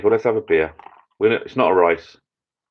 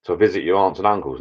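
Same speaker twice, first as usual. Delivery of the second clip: ordinary delivery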